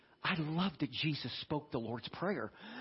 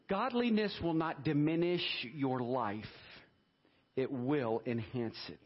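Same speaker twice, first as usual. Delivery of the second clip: usual speaking voice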